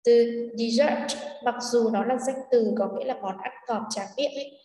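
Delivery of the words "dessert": "dessert" stressed on SSERT; light first syllable, strong second in "dessert". Shown as a demonstration. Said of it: "trọng âm của nó lại là số hai". (nhấn âm hai của "dessert")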